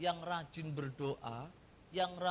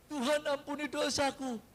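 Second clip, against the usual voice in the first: high, louder begging plea; pleading believer; 8 semitones higher, 5 dB louder than usual. Retold or imitated. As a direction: cry out to God for mercy. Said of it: "pleading prayer". (jemaat memohon kepada Tuhan dengan suara tinggi dan keras)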